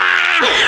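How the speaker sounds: short unvoiced mock wail; mock baby cry. Mother imitating her baby son crying out, mock wail. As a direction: imitate the baby's startled cry.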